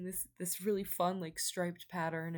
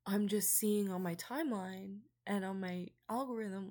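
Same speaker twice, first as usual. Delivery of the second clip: normal talking voice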